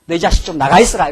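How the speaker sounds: put-on loud, scolding father shooing his child out; mock-angry father telling his kid to get out. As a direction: bark it like a fed-up dad. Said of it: "집에서 화가 한번 나면은".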